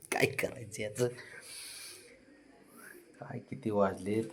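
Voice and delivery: whispered aside with a laugh. Real, not acted. Owner mutters about the stubborn dog, whispered laugh. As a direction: breathe it out, half laughing.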